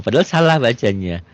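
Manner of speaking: ordinary talk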